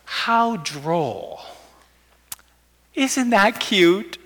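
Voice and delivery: the emperor mocks a loving offer, slow sneering voice; disdainful emperor. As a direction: brush off the offer like a smug tyrant. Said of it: "And they go".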